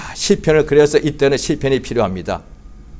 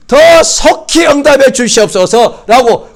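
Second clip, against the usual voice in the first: slow, loud, higher cry with 더 stretched; fervent plea; 8 semitones higher, 5 dB louder, slower than usual. Acted. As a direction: shout 더 속히 as a desperate prayer. Sermon, fervent shouted plea. (더를 길게 끌며 높고 크게 외치는 간구)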